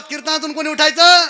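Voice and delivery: high, forceful shouted question; rousing call-and-response shout; loud crowd prompt. Shown as a demonstration. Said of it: "जोरात, जोरात".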